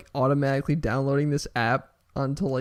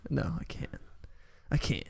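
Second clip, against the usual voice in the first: usual speaking voice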